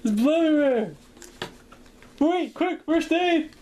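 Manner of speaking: high, loud panicked cry for help; panicked yelling. Pretend. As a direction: shout in mock panic for first aid. Panicked imagined reaction to injury.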